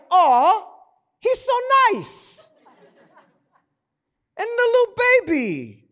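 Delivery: high, cooing imitation of his wife; mock gushing wife. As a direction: coo over the players like she does. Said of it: "She's like".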